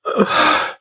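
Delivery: high, shaky breath out after sobbing; a trembling sigh while crying; tearful sigh. Real, not acted. Father recovering from sobs on video, tearful sigh. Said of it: "I'm hurting so bad".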